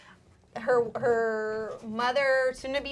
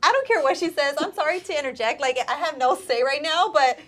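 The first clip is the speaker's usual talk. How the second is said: louder and higher, pressing to leave, laughing through it; urgent rising plea; 5 semitones higher, 9 dB louder than usual. Retold or imitated. Stylist pleading with bride's family, urgent and laughing. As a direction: push hard, half laughing, I need to go.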